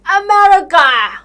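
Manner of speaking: big sarcastic announcement; mock-patriotic. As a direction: proclaim it with sarcastic grandeur. Mocking American heroism in films.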